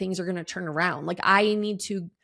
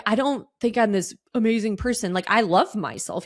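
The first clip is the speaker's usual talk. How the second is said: usual speaking voice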